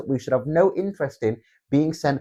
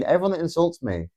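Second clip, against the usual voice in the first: ordinary delivery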